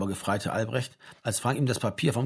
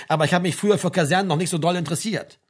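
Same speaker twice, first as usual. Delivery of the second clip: quick, louder snide retort; venomous; 6 semitones higher, 5 dB louder, faster than usual. A narrator's reading. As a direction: fire it back higher, with spite. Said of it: "sagte Frank giftig". (giftig, schnell und lauter)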